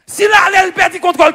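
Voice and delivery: quick, projected preacher's delivery; forceful retold question. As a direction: throw it out firmly and fast. Repeated out loud.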